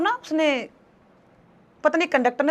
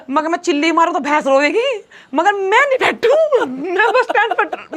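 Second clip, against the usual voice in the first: laughing; 5 semitones higher, 6 dB louder than usual. Real, not acted. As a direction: giggle as you tell it. Laughing while retelling the refusal.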